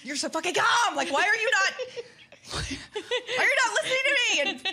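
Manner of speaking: high, loud, laughing exasperated yell. Retold or imitated. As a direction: yell at husband, half laughing. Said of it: "And I'm like".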